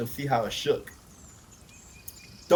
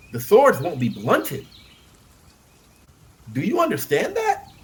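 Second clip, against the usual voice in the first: rising alarm, slow and louder; worried young knight; 7 semitones higher, 6 dB louder, slower than usual. A narrator's reading. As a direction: warn him as the fear climbs. Raymond voiced in a story.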